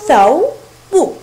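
ثوب split into two long, loud syllables. Shown as a demonstration. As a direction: say ثوب slowly in two separate beats. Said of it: "فتصبح الكلمة من مقطعان".

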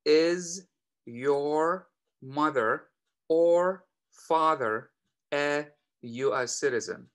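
very slow, word by word; slow model question. Shown as a demonstration. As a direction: stretch the question out for learners. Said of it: "السؤال آخر". (سؤال بطيء جدًا)

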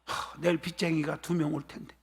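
sighing, low and soft; acted worried brooding. Preacher plays debtor dreading creditors, worried sigh. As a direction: sigh and fret under your breath. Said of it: "몸은 여기 있지만 마음이 딴 데가 있어".

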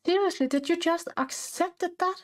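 incredulous. Real, not acted. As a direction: really hammer the disbelief. Repeats with more disbelief.

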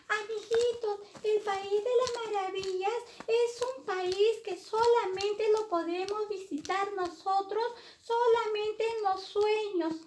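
high-pitched puppet-like little character; Paquito. Pretend. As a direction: explain to little friends in a very high voice.

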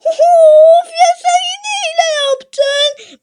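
high-pitched friend shouting accusations; whiny accusing friend. Acted. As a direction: play the sore-loser buddy yelling cheat.

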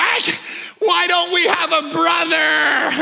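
high, drawn-out mock wail; exasperated daughter. Oldest daughter cracks while fighting the skunk, mock exasperated wail. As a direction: wail it out like a fed-up girl. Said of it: "finally, she's like".

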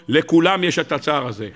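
usual speaking voice

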